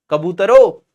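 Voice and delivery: normal talking voice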